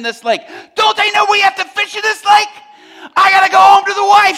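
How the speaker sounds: furious high-pitched full shout; ranting fisherman at the top of his lungs; Peter hollering at crew. Acted. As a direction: holler orders at your crew, exasperated. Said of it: "while he's hollering at his crew".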